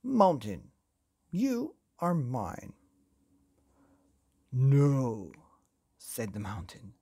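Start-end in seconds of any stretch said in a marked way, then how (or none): 0.0-2.7 slow, deliberate, commanding claim; clear voice. A narrator's reading. Fausto claiming the mountain as his, in a clear voice. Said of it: "Fausto said in a clear voice". 4.5-5.3 loud, weighty refusal; defiant mountain. A narrator's reading. Mountain refusing to be owned by Fausto, defiant.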